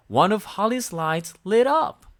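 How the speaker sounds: ordinary delivery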